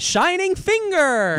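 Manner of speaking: loud, slow attack-name call; hyped announcement. Acted. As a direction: shout the move name like an anime.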